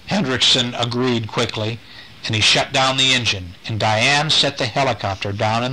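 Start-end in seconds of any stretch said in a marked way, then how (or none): none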